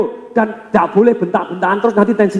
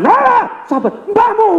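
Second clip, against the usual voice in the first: hammed-up angry customer; angry retort; slower than usual. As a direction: snap back at being told to calm down. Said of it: "Yang sembilan puluh delapan persen lagi".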